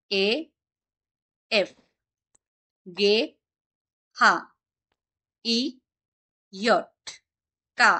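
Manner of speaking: each letter slowly, with a pause after; alphabet recitation. Shown as a demonstration. Teacher recites German alphabet slowly for late students. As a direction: recite letters one by one, measured. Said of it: "pronunciation গুলো একবার just".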